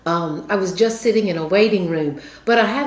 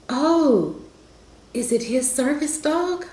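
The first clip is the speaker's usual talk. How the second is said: high-pitched surprised question from the lady; 8 semitones higher than usual. Retold or imitated. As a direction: raise the voice high, imitating her surprise. Retold lady asks about dog.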